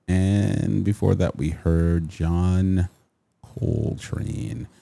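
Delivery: slow, soft, smooth jazz DJ voice. Shown as a demonstration. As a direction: ease into each word like late-night jazz radio. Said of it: "I don't know why I'm talking so slow".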